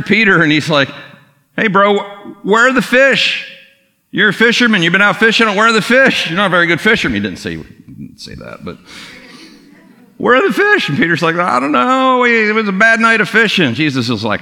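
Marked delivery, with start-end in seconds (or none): 1.6-7.2 high, bright-eyed ribbing of a pal; teasing buddy. Retold or imitated. Jesus teasing Peter about the missing fish, high and playful. 10.2-10.9 very high, playful needling question; teasing buddy. Retold or imitated. Jesus needling Peter again, very high and playful. 11.4-13.7 sheepish fisherman. Retold or imitated. Peter voiced answering, sheepish.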